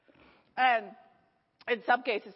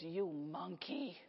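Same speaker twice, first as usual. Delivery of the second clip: lower, slow, hushed sneer; hostile old patient; 6 semitones lower, 11 dB quieter, much slower than usual. Retold or imitated. Angry patient insulting the young nurse, slow low sneer. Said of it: "She goes".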